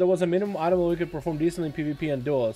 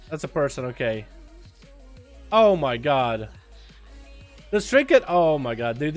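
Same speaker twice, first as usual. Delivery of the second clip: ordinary talk, nothing marked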